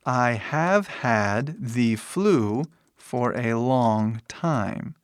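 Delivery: slow, spaced-out teaching delivery; slow model sentence. Shown as a demonstration. Teacher shows students how to express time, slowly. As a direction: model each English word unhurriedly.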